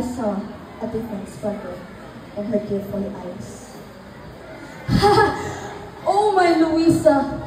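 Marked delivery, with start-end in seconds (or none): 4.9-6.0 a breathy mad laugh out of nowhere; crazed laugh. Acted. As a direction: laugh like she's lost her mind. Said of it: "She went out of her mind".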